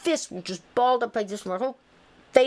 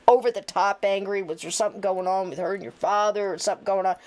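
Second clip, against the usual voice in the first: ordinary talk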